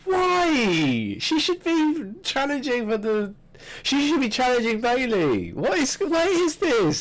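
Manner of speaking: exasperated, can't believe the booking; indignant outrage. Real, not acted. Co-host ranting to co-host about the booking, high indignant disbelief.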